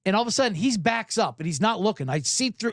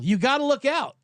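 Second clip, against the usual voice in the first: raised-pitch mock shout of warning; retold yell; 7 semitones higher than usual. Golfer warning a careless driver, mock yell. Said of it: "I yell".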